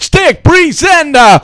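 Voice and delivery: hammed-up announcer bellow; slow shout. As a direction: drag out Presenter as a yell. Catchphrase yelled slowly.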